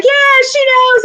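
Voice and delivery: high, loud, drawn-out holler; acted fan cheer. Imagining WrestleMania crowd agreeing, high excited cheer. Acts out calling out among fans.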